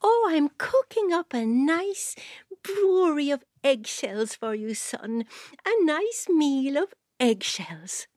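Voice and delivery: high, slow, sugary mother; sweet mother voice. Acted. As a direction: coo it sweetly and slowly.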